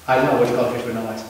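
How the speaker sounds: rattled off fast, flat and low; fast reply. Retold or imitated. Scene partner rushing the setup line to the comic. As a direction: hurry the feed line out low.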